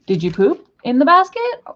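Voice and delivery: high, loud voice to the pet; pet talk. Real, not acted. Owner asking her pet about the mess, high and loud.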